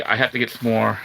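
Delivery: ordinary talk, nothing marked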